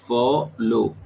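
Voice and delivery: फॉलो split into फॉ, then लो; teacher breaking फॉलो in two; syllable breakdown. Shown as a demonstration. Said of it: "double syllables बोले तो".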